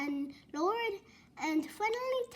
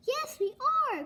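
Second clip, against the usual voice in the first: excited cry; 8 semitones higher than usual. A narrator's reading. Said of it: "cried Grandma".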